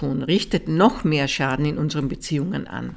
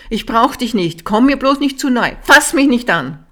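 louder, higher, pushing the partner away; angry rejection; 7 semitones higher, 6 dB louder than usual. Retold or imitated. Angry partner telling the other to stay away, rising anger. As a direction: snap it out, building in anger. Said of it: "Aber wenn sie wütend sind".

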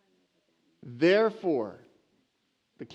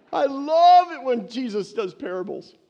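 excited crowd; 8 semitones higher, 8 dB louder than usual. Retold or imitated.

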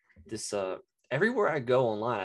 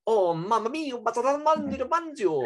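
put-on much higher, louder, over-the-top Italian accent; sudden Italian; 12 semitones higher, 5 dB louder than usual. Mocking TikTok cooks who suddenly break into hammed-up Italian. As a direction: burst into cartoonish Italian mid-recipe. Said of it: "break into the Italian".

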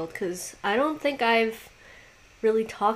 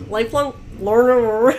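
louder, choked cartoon voice; Lola from Big Mouth; 6 dB louder than usual. Co-host teasingly mimicking a cartoon character, strangled voice. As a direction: drag out the ending as if choking. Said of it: "That's my Lola impression".

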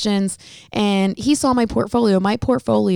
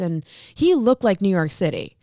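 ordinary delivery

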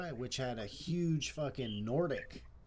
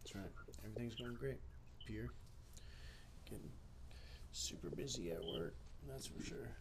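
whisper; 12 dB quieter than usual. Real, not acted. Whispered aside on stream.

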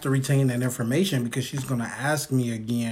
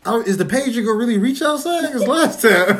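hammed-up clinic chatter, louder, through laughs; mock nurse talk, laughing; 7 semitones higher, 6 dB louder than usual. Acting out a clinic visit with mom, laughing. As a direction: play it loud and giggly.

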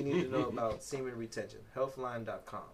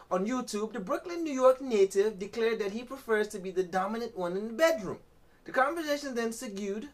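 high-pitched, put-on Jamaican article read; Jamaican accent; 6 semitones higher, 4 dB louder than usual. Host reads gossip article to co-host in a Jamaican accent. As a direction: play newsreader with a lilting Jamaican accent. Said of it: "My Jamaican accent".

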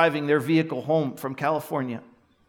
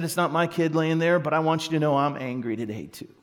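normal talking voice

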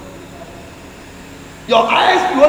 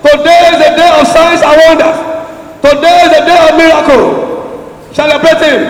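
booming motivational speech; 3 semitones higher than usual. Acted. Preacher rousing congregation to celebrate.

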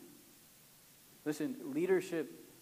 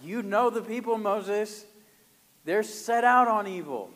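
higher, louder pleading excuse; Aaron passing the blame, higher and louder; whiny blame shifting; 5 semitones higher, 7 dB louder than usual. Retold or imitated. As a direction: push the blame onto the people, voice up.

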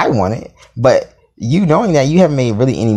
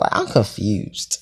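ordinary talk, nothing marked

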